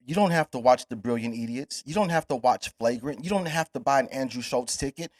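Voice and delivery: ordinary delivery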